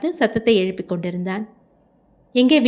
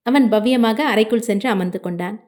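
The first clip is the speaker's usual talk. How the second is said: ordinary delivery, nothing marked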